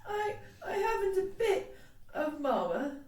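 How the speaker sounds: high, halting tearful lament; woeful small-child voice; childish wail. A narrator's reading. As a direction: stammer it out in a little girl's wail. Lottie wailing about her mama.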